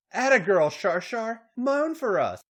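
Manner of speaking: high, loud eager cheering; cooing. Acted. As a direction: egg her on loudly. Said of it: "Paige cooed".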